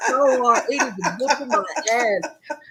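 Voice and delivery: talking through laughter; breaking up with laughter, high and loud; laughing. Real, not acted. Laughing fit over sparkling water.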